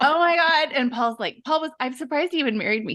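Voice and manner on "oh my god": shocked. Retold or imitated. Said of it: "And I was like".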